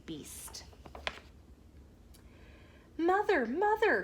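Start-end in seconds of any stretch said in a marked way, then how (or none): 3.0-4.0 high, loud urgent call. A narrator's reading. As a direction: call for mother while hurrying. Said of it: "called out Radius".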